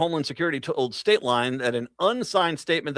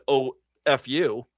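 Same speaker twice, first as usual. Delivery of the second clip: mock defiance; slower than usual. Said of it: "To which the state said".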